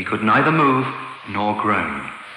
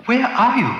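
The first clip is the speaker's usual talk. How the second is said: put-on young girl voice; 13 semitones higher than usual. A narrator's reading. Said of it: "Dorothy asked".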